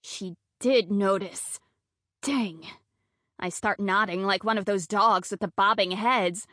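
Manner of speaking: ordinary delivery, nothing marked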